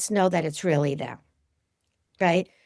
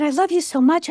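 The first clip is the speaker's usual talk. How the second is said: tender cry; 9 semitones higher, 4 dB louder than usual. Retold or imitated. Calling to bird again.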